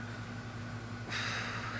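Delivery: soft stifled burp; a quiet real burp. Real, not acted. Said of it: "I'm sorry that I'm burping".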